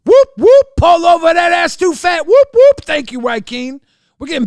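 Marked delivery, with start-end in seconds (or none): 0.0-2.8 high, loud whooping catchphrase; playful pull-over shout, pitched way up; mock police siren. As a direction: whoop like a siren, then call out. Donation alert catchphrase, siren whoops.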